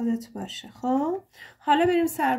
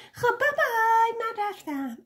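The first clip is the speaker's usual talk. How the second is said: mock bye-bye; 10 semitones higher than usual. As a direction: mimic a listener's breezy high goodbye. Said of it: "بعد بگی بابای". (خداحافظی نمایشی با صدای زیر)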